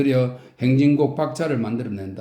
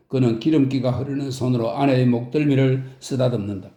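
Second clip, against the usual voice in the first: ordinary delivery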